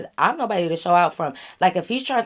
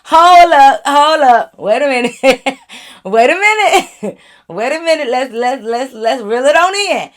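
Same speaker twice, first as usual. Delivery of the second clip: loud, breaking into laughter, higher; laughing; 4 semitones higher, 8 dB louder than usual. Real, not acted. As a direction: try to stop him while laughing hard. Cracking up at his rap.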